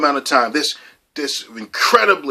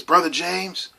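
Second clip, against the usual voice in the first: pastor's greeting; 6 semitones higher than usual. Retold or imitated. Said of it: "he would always say this".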